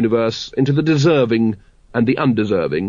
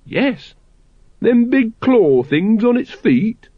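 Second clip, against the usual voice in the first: much higher, slow, anxious questions; nervous Watchtower; 10 semitones higher, much slower than usual. A narrator's reading. Watchtower quizzing the Grand Master about dragons, nervously. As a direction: ask with growing alarm.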